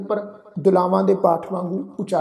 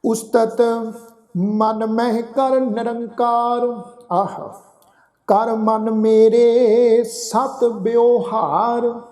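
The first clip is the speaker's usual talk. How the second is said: very slow, drawn-out Gurbani recitation; scripture recitation; 3 semitones higher, 3 dB louder, much slower than usual. Acted. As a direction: recite each phrase slowly, holding the long vowels. Recites scripture between explanations.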